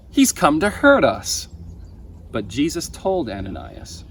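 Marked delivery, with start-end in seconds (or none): 0.1-1.5 alarmed protest. A narrator's reading. Ananias fears Saul.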